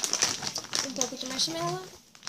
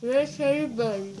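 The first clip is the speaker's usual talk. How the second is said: talking through stuffed cheeks; words mushed by marshmallows; mouth full; 6 dB louder than usual. Real, not acted.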